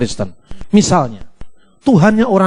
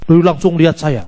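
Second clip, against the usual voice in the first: usual speaking voice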